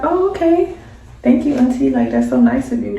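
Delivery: delighted thanks; pleased surprise. Retold or imitated. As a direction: thank auntie brightly. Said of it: "So you like".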